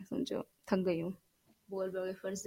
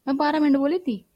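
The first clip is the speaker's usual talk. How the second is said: much louder, higher frustrated outburst; exasperated; 6 semitones higher, 9 dB louder than usual. Real, not acted. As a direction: vent that twelve minutes were lost. Recording lost, exasperated. (झुंझलाहट में ऊँची आवाज़)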